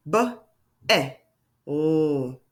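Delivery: B, eh, oh as three separate sounds, long L for "oh"; word tapped out. Shown as a demonstration. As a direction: sound out "B, eh, oh" with clear gaps. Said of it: "Let's try that again".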